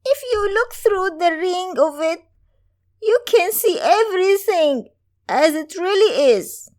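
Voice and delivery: a wise crone revealing a secret; mysterious old woman. Acted.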